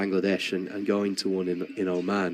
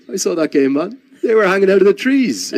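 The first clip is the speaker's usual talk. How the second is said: much higher, much louder excited exclamation; Chris Gayle, excited; 12 semitones higher, 11 dB louder than usual. Retold or imitated. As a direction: burst out thrilled, imitating a cricket star.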